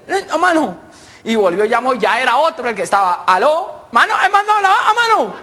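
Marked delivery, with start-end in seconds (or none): 0.1-0.8 high, frantic garbled voice; garbled caller. Acted. Caller reporting to a man who understands nothing, garbled. 3.3-5.3 high, frantic garbled voice; garbled caller. Acted. Caller reporting to a man who understands nothing, garbled. Same caller rings back.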